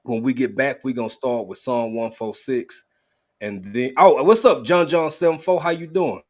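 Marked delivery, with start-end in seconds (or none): 4.0-6.2 excited greeting. Real, not acted. Greeting a viewer in chat.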